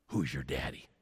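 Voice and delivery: hushed, as if whispered around town; quiet murmur. Retold or imitated. That's what they all asked.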